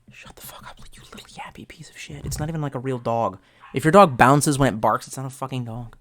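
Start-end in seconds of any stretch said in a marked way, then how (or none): 0.1-2.2 hissed curses under the breath; angry whisper. Real, not acted. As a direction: whisper through clenched teeth at a yappy dog. Cursing the barking dog.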